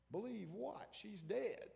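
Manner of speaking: high, disbelieving scoff; incredulous scoff. Retold or imitated. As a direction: toss it back in mock disbelief. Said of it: "mocking disbelief voice".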